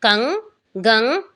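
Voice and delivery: कंग, गंग said very slowly, each nasal ng sound drawn out; teacher sounding out कंग, गंग with a clear nasal ng; slow clear demo. Shown as a demonstration. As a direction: model कंग and गंग slowly for a child to copy. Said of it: "उसका उच्चारण करके दिखाना है".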